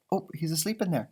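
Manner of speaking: ordinary delivery, nothing marked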